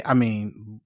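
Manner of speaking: ordinary talk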